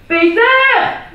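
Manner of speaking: high, loud angry shout. Acted. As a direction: yell his name in fury. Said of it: "schreeuwden mama en papa".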